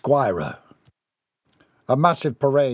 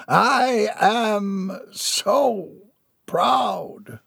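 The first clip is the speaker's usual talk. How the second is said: mock-moved, very slow, higher voice; a hint of a proud tear in the voice; a touch of tearful pride; 8 semitones higher, much slower than usual. A narrator's reading. Father gazing at his wife on stage, mildly tearful. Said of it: "wiped a tear from his eye".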